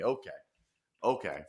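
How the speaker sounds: ordinary talk, nothing marked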